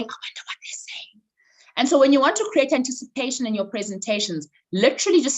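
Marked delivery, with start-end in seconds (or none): 0.0-1.2 quick, hushed gossip whisper; water cooler voice. Acted. Coach imitating overheard office talk, fast whisper. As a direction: whisper it with anticipation. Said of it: "that water cooler voice".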